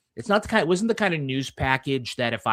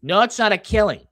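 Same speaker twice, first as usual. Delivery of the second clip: Boston accent, louder on Killing; 5 semitones higher, 6 dB louder than usual. Shown as a demonstration. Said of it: "You're not saying it right".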